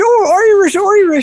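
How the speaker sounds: high, teasing fake-Irish echo; mock Irish accent. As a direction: parrot IRISH back in an exaggerated Irish accent. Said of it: "and you went".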